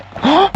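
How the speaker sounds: quick rising gasp of surprise; a real little gasp of fright; short startled gasp. Real, not acted. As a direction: jolt awake with a sharp breath in. Startled by a noise while resting.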